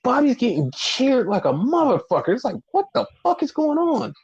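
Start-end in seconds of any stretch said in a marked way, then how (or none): 2.7-4.1 baffled. Real, not acted.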